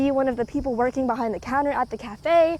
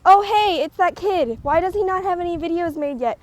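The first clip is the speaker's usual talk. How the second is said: bright, louder surprise at spotting him; a loud, delighted 'oh hey'; surprised; 5 semitones higher, 6 dB louder than usual. Retold or imitated. To herself, spotting her coworker's empty channel, loud surprised exclaim. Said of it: "and I was like".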